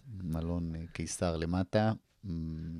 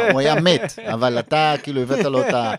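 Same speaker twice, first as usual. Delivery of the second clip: loud, laughing through the words; real laughter, high and loud; 7 semitones higher, 11 dB louder than usual. Real, not acted. Laughing at the absurd death.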